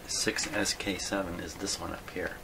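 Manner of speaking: ordinary talk, nothing marked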